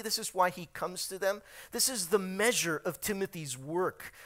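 normal talking voice

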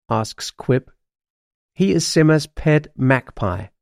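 usual speaking voice

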